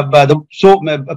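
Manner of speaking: normal talking voice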